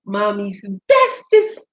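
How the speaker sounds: ordinary delivery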